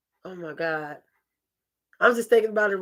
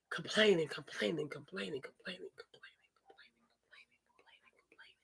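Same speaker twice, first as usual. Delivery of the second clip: each complaining quieter until it dies into a whisper; fading mock complaining; 5 semitones lower, 16 dB quieter than usual. Mocking men who say women nag, fading to a whisper. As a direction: let the word trail off to a whisper.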